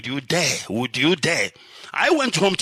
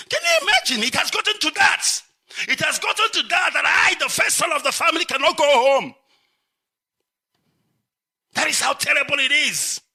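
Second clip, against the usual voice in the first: anguished outcry; 9 semitones higher than usual. Real, not acted. Lamenting he cannot go home.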